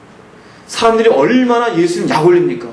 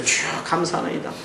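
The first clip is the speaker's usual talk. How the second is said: whispered mock prayer; 10 dB quieter than usual. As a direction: whisper a pious prayer mockingly. 위선적 기도 흉내. (속삭이듯 위선적으로 기도하는 흉내)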